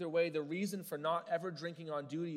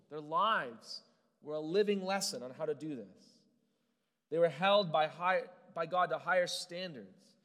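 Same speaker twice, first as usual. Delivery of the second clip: louder, slow, emphatic sermon build; forceful, deliberate preaching; preaching at full force; 3 semitones higher, 12 dB louder than usual. Acted. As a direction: drive the point home from the pulpit.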